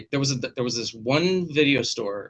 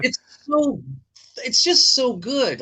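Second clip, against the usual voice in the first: high, loud gushing praise; 13 semitones higher, 4 dB louder, slower than usual. Real, not acted. Fan raving about Creepshow, high excited gush. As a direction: rave about it, pitch soaring.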